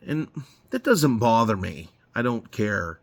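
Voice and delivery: ordinary delivery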